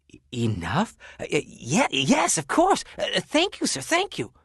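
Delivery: stunned thanks, tripping over the words; stammering surprise. Acted. Stunned shoemaker thanks the rich man, high and stammering.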